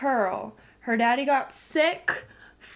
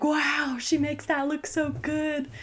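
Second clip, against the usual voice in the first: awed fan; 5 semitones higher, slower than usual. Retold or imitated. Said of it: "be like".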